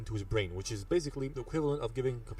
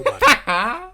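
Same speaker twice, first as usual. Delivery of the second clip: loud, slow hammed-up line; laughing shout; 16 dB louder, much slower than usual. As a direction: boom it out while cracking up.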